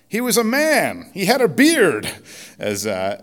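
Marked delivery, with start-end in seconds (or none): 0.1-2.1 high-pitched, indignant protest; Chip. Acted. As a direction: protest loudly in an acted character voice. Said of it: "as Chip protests".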